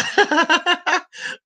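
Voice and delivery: high delighted giggle; a thrilled little squeal of a laugh. Real, not acted. Vlogger delighted by the food, high squealing laugh.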